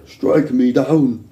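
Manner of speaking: slow, heavy mock Arnold growl; drawn-out action hero line; Arnold impression. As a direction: ham up the Arnold Schwarzenegger voice. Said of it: "I almost went Arnold there".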